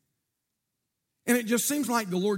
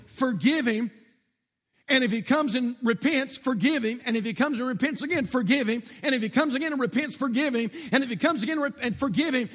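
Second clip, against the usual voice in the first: louder rhythmic preaching, repeating the refrain; preaching at full force; 4 semitones higher, 4 dB louder than usual. Acted. Preacher hammering Jesus' words on forgiveness, loud and rhythmic. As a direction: build the refrain over and over.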